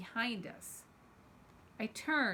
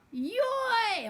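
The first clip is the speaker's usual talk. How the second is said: sharp, much higher, loud cry; sharp call; 12 semitones higher, 5 dB louder than usual. Acted. An old man calling out as he flips the bully. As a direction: bark it as you grab him. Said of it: "a voice called".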